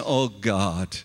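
low, soft, broken moan; a low, soft, pained moan to God. Retold or imitated. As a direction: groan it out low like a man in unbearable pain. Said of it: "and he's moaning, saying".

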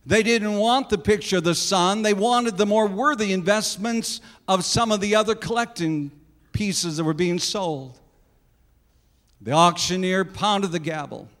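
ordinary delivery

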